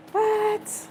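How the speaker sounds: shocked, pitch jumps way up; disbelieving squeak; quiet high astonishment; high squeaky what. Real, not acted. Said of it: "card surprises again".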